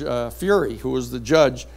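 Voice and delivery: ordinary talk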